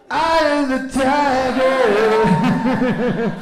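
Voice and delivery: high, loud drawn-out bellow; hammed-up heroic shout; mock-heroic roar. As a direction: roar it out like a triumphant war cry. Comic roared boast to fans.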